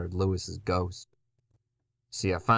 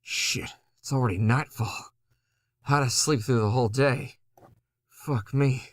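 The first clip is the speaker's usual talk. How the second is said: frustrated panic; 6 semitones higher, 3 dB louder than usual. Acted. Wakes late, panics.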